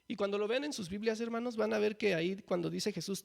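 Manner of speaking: normal talking voice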